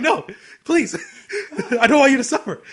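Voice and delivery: high, loud plea; retold pleading. Begging a friend not to watch it, pleading. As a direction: beg him not to, voice jumping up.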